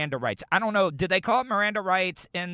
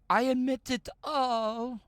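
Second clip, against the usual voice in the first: a bad, slow British accent, mimicking the lady; horrible British accent; 8 semitones higher, 4 dB quieter, slower than usual. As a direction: imitar torpemente a una señora británica. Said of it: "I know I do a horrible British accent".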